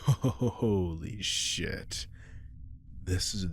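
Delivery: slow, drawn-out, awed; blissed out. Acted. Vampire savouring the best blood in centuries, awed. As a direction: stretch it out in pure delight.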